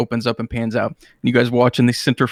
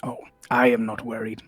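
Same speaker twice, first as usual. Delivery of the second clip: put-on old wizard. GM voices old wizard Malcador.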